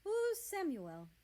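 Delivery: put-on high, slow, surprised young voice; Russia's startled question. A narrator's reading. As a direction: ask it wide-eyed and lifted.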